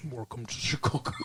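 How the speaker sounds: low, soft Scottish slur; a hushed, slushy movie-star burr; Sean Connery impersonation. Acted. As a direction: say it low as Connery playing a Chicago cop. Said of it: "And he's like".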